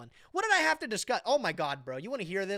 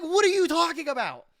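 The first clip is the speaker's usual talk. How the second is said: higher, louder mock outrage; incredulous; 9 semitones higher, 7 dB louder than usual. Retelling his reaction to a friend, loud incredulous question. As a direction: throw it back in stunned disbelief. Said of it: "I was like".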